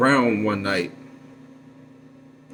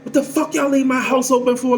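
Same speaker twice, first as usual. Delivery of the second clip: much higher, sharp yell of an angry mother. Retold or imitated. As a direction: shout at the boys like a furious mom. Mother furious about open house.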